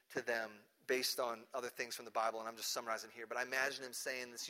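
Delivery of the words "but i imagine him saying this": ordinary talk, nothing marked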